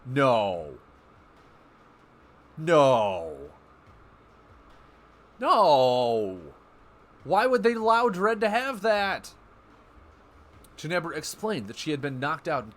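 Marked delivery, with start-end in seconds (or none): 0.1-6.4 loud repeated no in disbelief; loud refusal. Real, not acted. Streamer reacting to an enemy card, loud disbelieving nos. Shocked by opponent's card text. 7.2-9.3 high, loud outraged question; indignant disbelief. Real, not acted. Streamer complaining to chat about an enemy card, indignant.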